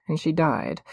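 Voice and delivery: plain reading voice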